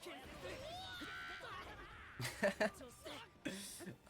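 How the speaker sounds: long high-pitched giggle fading out; breathless high laugh at the screen; high squealing laugh. Real, not acted. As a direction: let a shrill delighted giggle run out. Laughing at a game kill.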